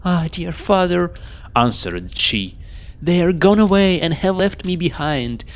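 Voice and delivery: put-on a small girl grieving her brothers; sad young princess. Daughter telling her father the brothers left her, plaintive.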